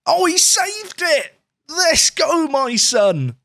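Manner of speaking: high, slow, real whoop of joy at the save; elated cheer. Real, not acted. Manager cheers his keeper saving the penalty, elated and high.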